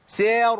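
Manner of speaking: a high, loud سير, strained with effort; effortful wrong. Shown as a demonstration. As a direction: push out سير as if it costs your breath. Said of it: "حسيت إن نفسي هيروح".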